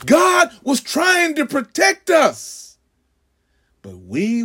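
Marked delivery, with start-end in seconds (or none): 0.1-2.8 slow preaching shout. Acted.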